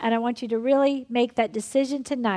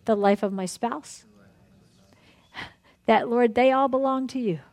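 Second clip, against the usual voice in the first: earnest prayer; 2 semitones lower than usual. Acted. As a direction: pray it out for the whole room. Leads a group prayer.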